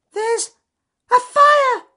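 very high, loud shriek; terrified shriek. A narrator's reading. Francis Field Mouse warning everyone of the fire, high terrified shriek. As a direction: shriek the alarm. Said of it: "shrieked Francis Field Mouse".

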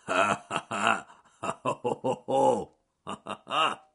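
spelt-out ha ha ha laugh; hearty laughter. Acted. Bear finally getting the joke, laughing.

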